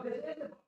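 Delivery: IS pushed up high in a soft voice; softly insist, lifting the word "is"; emphatic, convincing. Shown as a demonstration. Teacher trying to convince someone, stress on IS. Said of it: "I'm trying to convince you".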